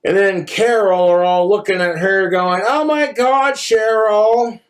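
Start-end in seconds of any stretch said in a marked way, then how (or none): 2.7-4.6 mock gushing girlfriend voice, a bit higher; fake-sweet gushing over a purse; gushing gal pal.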